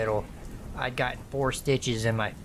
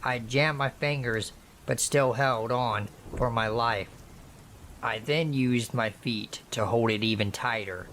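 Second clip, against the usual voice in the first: usual speaking voice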